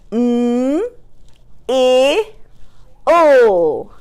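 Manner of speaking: "M, I, o" hummed and held as separate sounds; chopped-out word. Shown as a demonstration. Teacher sounding out a word for pupils, chopped apart. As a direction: stretch MILL sound by sound. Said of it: "chop out this word".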